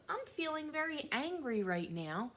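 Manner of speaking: put-on a high, childlike "I'm feeling very angry"; modeling child speech. Teacher modeling what to tell friends, in a high childlike voice. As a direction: model the words a child would say. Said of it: "you should try to tell your friends".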